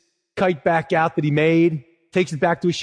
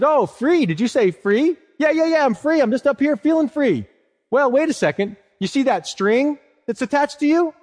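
higher, teasing crow voice; sly crow; 7 semitones higher than usual. Acted. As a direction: tempt the kite like a sly bird. Crow voice in kite story.

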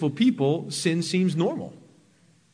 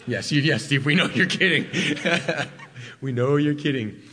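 mock congregation laughing. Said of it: "people laugh when I say that".

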